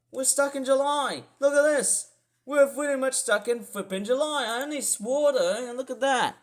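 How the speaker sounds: high, loud indignant rant; ropeable. Real, not acted. Weather vlogger fed up with July forecast, ropeable rant. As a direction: rant in mounting disgust, voice climbing high. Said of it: "it just makes me feel ropeable".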